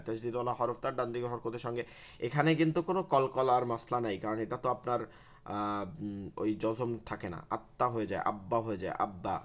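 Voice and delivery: usual speaking voice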